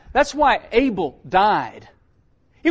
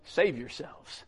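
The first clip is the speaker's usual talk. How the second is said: "Save yourselves" said much softer and slower, almost hushed; hushed repeat; 5 semitones lower, 9 dB quieter, slower than usual. Shown as a demonstration. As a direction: let "Save yourselves" sink in quietly and slowly. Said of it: "Save yourselves from this untoward generation".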